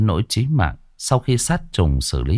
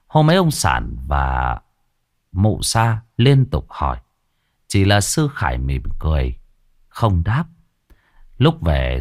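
ordinary delivery

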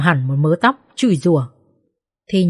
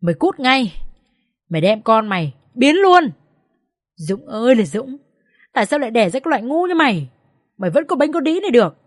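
high-pitched angry wailing, acted; narrated hysterical mother-in-law, no full scream; tearful screech; 6 semitones higher than usual. A narrator's reading. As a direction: berate the son, climbing higher and faster. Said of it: "khóc rít lên".

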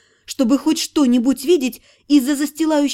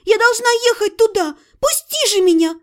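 much higher desperate shout; 8 semitones higher than usual. Acted. Heroine struggles in his arms, shouting high to be freed. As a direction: scream to be let go while struggling. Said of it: "кричала я, отбиваясь".

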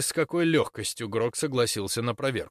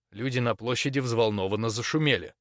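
usual speaking voice

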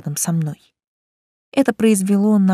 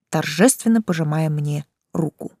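plain reading voice

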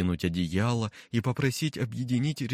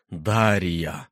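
louder, sharp call of Дарья; raised voice; 4 semitones lower, 5 dB louder than usual. A narrator's reading. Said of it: "Чуть повысил голос некромант".

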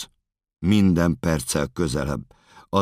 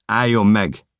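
high, loud command to halt; shouted warning; 8 semitones higher, 7 dB louder than usual. Acted. Officers ordering the runner to stop, shouted warning. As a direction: yell it as an order. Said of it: "kiabálnak".

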